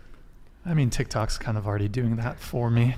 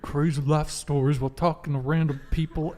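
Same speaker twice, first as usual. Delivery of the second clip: man doing a woman's voice; 2 semitones higher, 5 dB louder than usual. Retold or imitated. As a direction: imitate her voice, half-joking. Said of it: "can you impersonate my voice".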